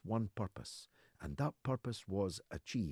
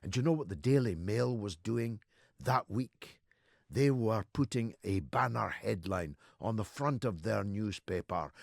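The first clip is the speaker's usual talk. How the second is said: normal talking voice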